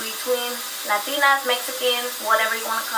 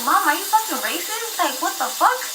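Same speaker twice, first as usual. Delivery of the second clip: shocked; 7 semitones higher than usual. Retold or imitated. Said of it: "And I'm like".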